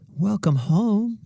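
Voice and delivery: high, slow, sing-song welcome; mother's welcome. Acted. Mother greeting her daughter home from school, high and sweet. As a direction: greet your child sweetly at the door.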